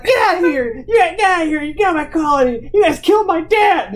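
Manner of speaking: high, hammed-up tearful yelling; fake crying. Kid raging at officers over his dad, fake crying. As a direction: yell through put-on tears. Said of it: "like, fake crying".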